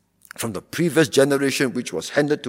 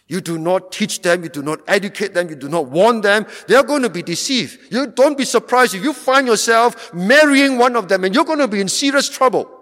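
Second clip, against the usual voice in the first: climbing higher and louder to a cry; rising sermon warning; 9 semitones higher, 6 dB louder than usual. Acted. Preacher warning parents about their children's friends, building.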